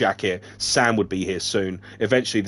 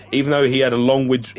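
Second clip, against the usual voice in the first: ordinary delivery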